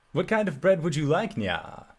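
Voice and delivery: perky cat-like cook, ending on nya; a cheerful catfolk chef asking an order; chef character voice. Acted. GM voicing the night chef.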